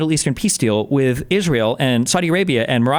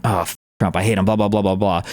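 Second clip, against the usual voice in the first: mock grumbling; 6 semitones lower than usual. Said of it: "I should be grumbling".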